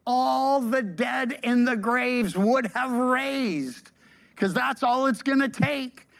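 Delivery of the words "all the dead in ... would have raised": normal talking voice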